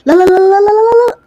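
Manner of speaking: high, loud frantic protest; panicked no. Real, not acted. Gamer panicking as his character fails, frantic no. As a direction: cry out in alarm.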